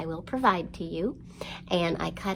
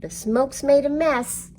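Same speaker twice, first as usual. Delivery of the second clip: mock fire chief shout, louder and higher; hammed-up shout; 7 semitones higher, 7 dB louder than usual. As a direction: call it out the door like a fire chief. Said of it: "called out the door with a shout".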